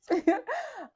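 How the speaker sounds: high giggle; high playful giggle; high laugh. Real, not acted. Laugh after you must clap.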